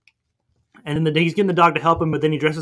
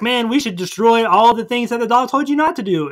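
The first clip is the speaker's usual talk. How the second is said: high alien voice; 7 semitones higher than usual. Retold or imitated. Alien voiced in retold movie.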